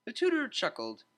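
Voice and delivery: plain reading voice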